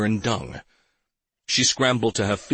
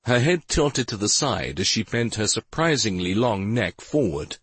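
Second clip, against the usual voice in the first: ordinary delivery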